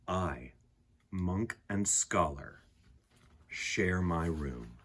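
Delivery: gentle poem reading. A narrator's reading. Reading the picture book poem to listening children, calm and soft. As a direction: read it like a quiet bedtime poem.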